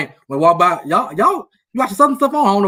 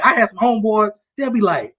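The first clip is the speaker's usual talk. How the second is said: ordinary talk, nothing marked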